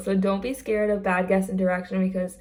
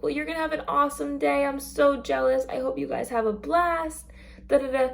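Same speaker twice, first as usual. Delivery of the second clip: higher, quick and cheery; upbeat cast member; 6 semitones higher than usual. Acted. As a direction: pump the kid up for the day. Cast member encouraging a child.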